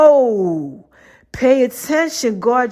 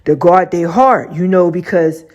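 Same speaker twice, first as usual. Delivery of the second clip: God's deep voice; 5 semitones lower than usual. Retold or imitated. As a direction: lay it down low like a wise elder. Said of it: "He's like".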